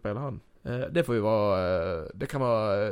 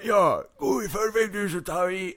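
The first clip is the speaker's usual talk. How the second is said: higher, put-on villain voice; wrong character voice; 6 semitones higher than usual. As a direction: start in a high character voice. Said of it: "nej, det är fel röst igen".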